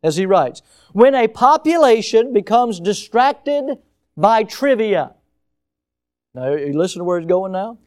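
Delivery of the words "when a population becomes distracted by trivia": raised, slow, weighty reading of a quote; dramatic quote reading. Acted. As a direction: read it like a warning. Reading Postman quote aloud.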